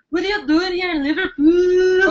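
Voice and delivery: put-on high, lilting accent, sort of Welsh; off-target accent imitation. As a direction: botch the Scottish accent towards Welsh. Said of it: "not Welsh or sort of Pakistani".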